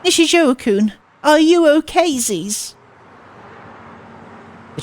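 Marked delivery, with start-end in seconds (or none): 0.0-2.8 put-on high, louder anxious little-girl voice; worried cutesy girl. Transfer student worried for Nishizuo-kun, high cutesy concern. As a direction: fret over him sweetly.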